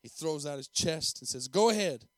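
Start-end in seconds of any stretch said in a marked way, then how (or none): 1.5-2.1 loud, cocky dare; defiant challenge. Acted. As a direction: throw it out boldly, daring them. Boy defies the principal, chest out.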